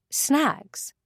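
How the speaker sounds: plain reading voice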